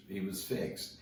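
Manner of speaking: usual speaking voice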